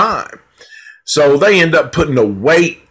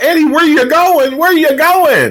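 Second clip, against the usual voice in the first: put-on much higher girl's voice calling after him; imitating Leslie calling. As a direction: call out high as the girl chasing him.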